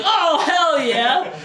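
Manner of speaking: very high, loud, slow drawn-out cheer; playful mock celebration. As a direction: stretch it out like a wild whoop. Gratch cheering, retold.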